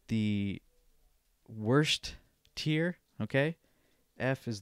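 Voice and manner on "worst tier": ordinary talk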